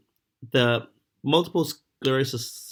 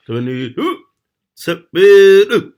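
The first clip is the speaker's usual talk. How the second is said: loud garbled bingo caller call; 7 semitones higher, 13 dB louder, much slower than usual. Retold or imitated. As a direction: bark out numbers like a caller. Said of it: "he'd be like".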